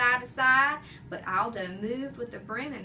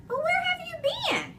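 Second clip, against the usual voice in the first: high question; 4 dB louder, faster than usual. A narrator's reading. Janitor question, odd high.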